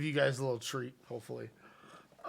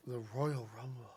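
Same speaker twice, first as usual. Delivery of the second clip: mock whisper; 2 semitones lower, 6 dB quieter than usual. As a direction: drop it to a near whisper, playfully. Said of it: "You gotta whisper, man".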